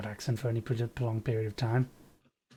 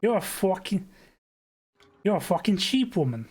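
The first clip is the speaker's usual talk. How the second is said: put-on higher-pitched New York accent; thick New York accent; 6 semitones higher than usual. Neighbour recognising the visitor at his door, thick New York accent. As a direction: blurt it like a startled New Yorker. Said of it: "his thick New York accent".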